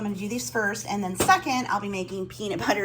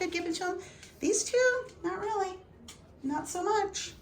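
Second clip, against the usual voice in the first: high, sing-song voice for the dogs; pet talk; 11 semitones higher, 4 dB quieter than usual. Real, not acted. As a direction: coo at the pugs, pitched up. Talks sweetly to her pugs.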